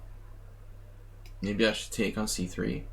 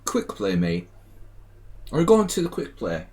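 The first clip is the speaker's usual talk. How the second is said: hammed-up British accent, pitched up on the last phrase; 3 dB louder than usual. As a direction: put on a rusty, unconvincing British accent. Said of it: "My British accent needs some, needs some brushing up".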